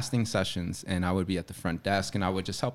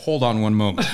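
laughing; 5 dB louder than usual. Retold or imitated. Receptionist quoted through laughter.

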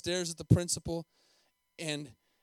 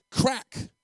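loud, high voiced whip crack; belt crack; 8 semitones higher, 7 dB louder than usual. A narrator's reading. Preacher narrates the beating, loud sharp crack.

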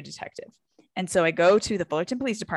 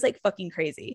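ordinary talk, nothing marked

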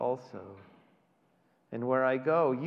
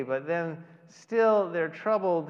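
normal talking voice

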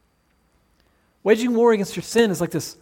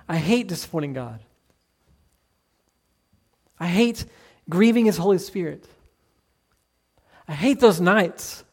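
confessional prayer. Acted. Voices a confessing believer's prayer.

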